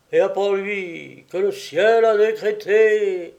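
high, loud archangel's declamation; solemn proclamation. A narrator's reading. Drunken husband as archangel wakes Louisette, solemnly proclaiming. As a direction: proclaim like a mock-grand herald from heaven. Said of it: "une voix solennelle proférait en bafouillant un peu".

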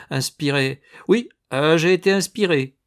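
en ricanant, plus aigu et plus fort; ricanement. A narrator's reading. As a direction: ricanez avec mépris. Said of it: "Il ricana". (ricanement moqueur)

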